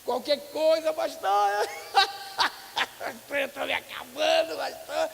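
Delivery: high, loud and laughing like a rowdy member; playful imitation of a loud, giggly believer; mock rowdy laughing. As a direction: ham up a noisy, cackling churchgoer. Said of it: "eu sou escandaloso demais".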